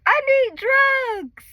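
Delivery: high, loud, slow plea for drugs; desperate plea. Retold or imitated. Laboring mother begging the nurses, desperate high plea. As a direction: beg for the epidural. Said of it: "I'm like".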